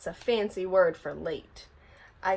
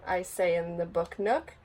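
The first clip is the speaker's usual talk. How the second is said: usual speaking voice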